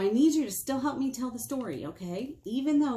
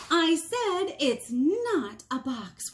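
slow, higher, louder insisting; insistent rabbit; 8 semitones higher, 5 dB louder, much slower than usual. Acted. Rabbit insisting to the questioner, slow and firm. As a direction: put your foot down, not a box.

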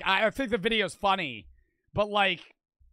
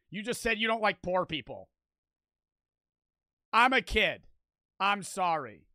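usual speaking voice

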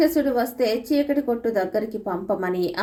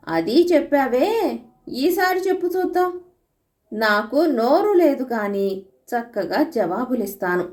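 high, strange monster voice, slowing at the end; a taunting demon, sure he has won; 4 semitones higher than usual. Acted. The demon taunts Anandudu with his last riddle, high strange monster voice.

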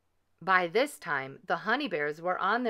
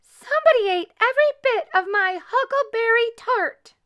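put-on very high, loud baby bear; loud, high-pitched little bear outcry; little bear squeal; 9 dB louder than usual. A narrator's reading. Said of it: "squealed Little Honey in his little bear voice".

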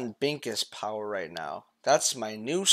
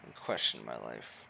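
dramatic monologue; 4 semitones lower, 5 dB louder than usual. Pretend. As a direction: recite it like a solemn confession. Mock-profound monologue.

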